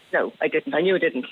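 ordinary delivery

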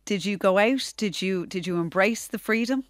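usual speaking voice

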